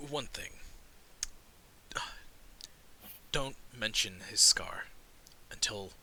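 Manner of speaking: low, quiet, with long pauses; hushed warning. Acted. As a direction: give a grave word of caution under your breath. Dwarf's companion warns Caleb before knocking.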